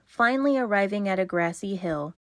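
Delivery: ordinary delivery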